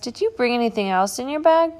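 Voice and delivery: high, loud and keen; impatient child asking about the bag; eager question. Acted. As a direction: ask it bursting with curiosity.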